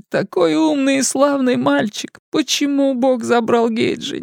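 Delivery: high, weepy woman's voice; shaken tearful witness. Acted. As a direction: sob it out, grieving and shaken. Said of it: "Мисси плакала, плакала навзрыд".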